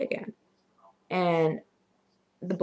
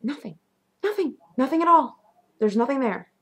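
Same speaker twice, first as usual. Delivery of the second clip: high, insistent "nothing"; freaked-out disbelief; 7 semitones higher, 3 dB louder than usual. Retold or imitated. Lights all on, looking all over, high freaked-out disbelief. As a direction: pitch it up, insisting on each repeat.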